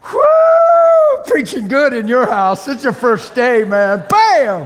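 high, loud preacher's hype; boisterous, shouted self-congratulation; exultant whooping. Acted. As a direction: whoop and cheer the preaching at full volume.

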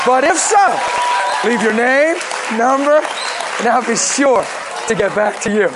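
high, loud voicemail greeting in short phrases; exaggerated phone message sign-off; answering machine greeting. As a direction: belt out the leave-a-message line, playfully. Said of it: "what used to be on my phone machine".

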